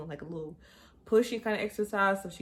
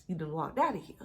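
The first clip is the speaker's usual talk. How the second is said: ordinary talk, nothing marked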